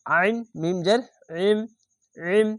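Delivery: thick ain at the start of ইম; throat-squeezed ain. Shown as a demonstration. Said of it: "মোটা করে গলা চিপে পড়বেন".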